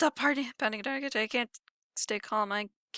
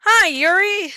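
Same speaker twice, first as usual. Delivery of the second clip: much higher and louder cheery hello; bright greeting; 10 semitones higher, 12 dB louder, slower than usual. Acted. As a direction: sing out the hello relieved. Greeting Monika in high voice.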